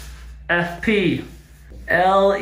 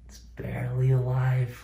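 whisper; 6 semitones lower, 9 dB quieter than usual. Real, not acted. Spider nearly dead, hushed.

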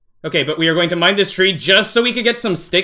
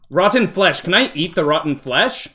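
usual speaking voice

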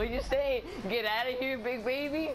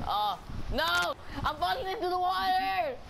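panicked cry; 7 semitones higher than usual. Real, not acted. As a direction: cry out as you tip over. Cart tipping toward water.

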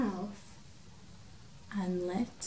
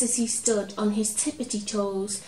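usual speaking voice

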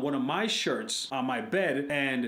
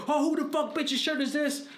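high-pitched mock confrontation; accusatory outburst; 13 semitones higher than usual. Girlfriend confronting him over a found shirt, accusatory outburst. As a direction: burst in high with an outraged demand. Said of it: "it's how you address it".

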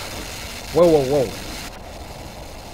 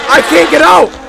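high, loud cry; panicked yell; 7 semitones higher, 9 dB louder than usual. Real, not acted. Player who can't get out, panicked yell. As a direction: yell it as if trapped. Trapped in the game.

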